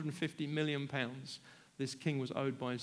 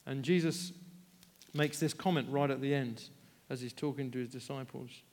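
ordinary talk

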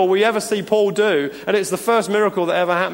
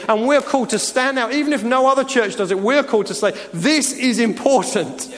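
higher, louder pulpit build; rising preaching; 4 semitones higher, 3 dB louder than usual. Acted. Pastor urging his church to be supernatural, higher and louder preaching. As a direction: drive each claim home to the church.

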